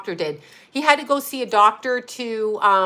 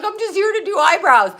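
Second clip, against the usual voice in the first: flustered protest; 9 semitones higher, 4 dB louder than usual. Retold or imitated. Jenny's flustered protest retold.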